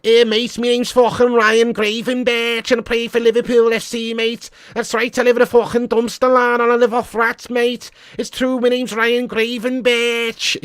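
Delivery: high, hammed-up Scouse accent; Ryan Gravenberch. Streamer playing a footballer for the chat, mock Scouse accent. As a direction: put on a comic, high-pitched Scouse voice. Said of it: "Gravenberg in Scouse accent is really funny".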